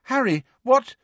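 put-on high girlish voice; young girl, puzzled; Ginny voice. A narrator's reading. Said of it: "who said".

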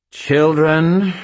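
high, loud sneering call; contemptuous. A narrator's reading. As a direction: call them with scorn. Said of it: "her tone full of contempt".

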